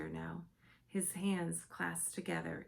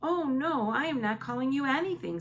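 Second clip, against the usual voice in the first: loud, higher, fast pleading; crying out; 8 semitones higher, 9 dB louder than usual. A narrator's reading. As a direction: Sophie rushing to apologise. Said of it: "Sophie cried".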